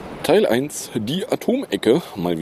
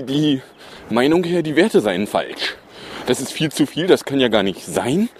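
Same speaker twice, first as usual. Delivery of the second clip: ordinary talk, nothing marked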